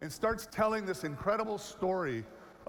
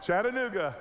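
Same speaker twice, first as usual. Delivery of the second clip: Chattanooga, mock Tennessee twang, louder; Southern accent; 4 dB louder than usual. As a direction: say Chattanooga like a Chattanooga local. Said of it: "the biggest accent you've ever known".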